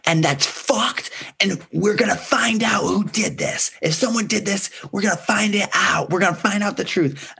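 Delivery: very high chest-puffed declaration; imitating Whitney. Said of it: "her whole chest is like".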